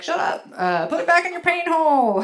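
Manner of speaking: loud mock scolding, rising high; dismissive jeer. Everybody silencing women on miscarriage, dismissive shout. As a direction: shout them down crudely.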